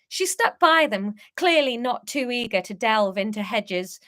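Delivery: plain reading voice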